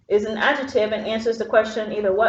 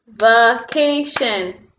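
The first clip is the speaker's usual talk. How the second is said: "vacation" very slow in three beats, va-ca-tion; split into syllables; 4 dB louder, much slower than usual. Shown as a demonstration. Teacher clapping syllables with pupils, "vacation" in three slow beats. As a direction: separate every syllable of "vacation", slowly and evenly. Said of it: "clap with me on the syllables".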